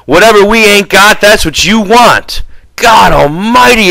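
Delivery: loud, high exasperated shouting; furious high-pitched outburst; exploding in anger. A narrator's reading. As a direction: blow up at your companion. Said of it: "George exploded".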